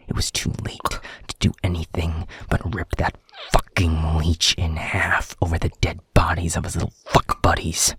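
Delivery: loud, venomous anger; furious recounting. Acted. Alpha tells subordinate of killing the vampire, furious and loud.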